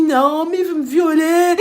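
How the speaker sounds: loud, slow, high mock wail; crying and yelling. Crying to those who came running, tearful yelling. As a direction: bawl the accusation, dragging it out. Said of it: "je commence à pleurer".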